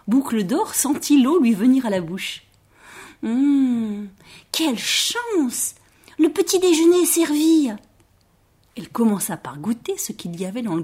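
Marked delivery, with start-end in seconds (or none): 3.2-7.7 exclamation ravie, plus aiguë, gourmande. A narrator's reading. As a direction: savoure la découverte d'un petit déjeuner. Girl delighted at the food. (enchantée, gourmande)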